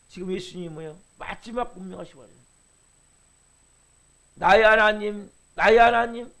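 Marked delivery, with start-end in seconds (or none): 4.3-6.3 louder, pleading call; anguished cry. Retold or imitated. Jesus on the cross calling to God, loud anguish.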